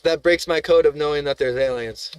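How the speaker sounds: ordinary talk, nothing marked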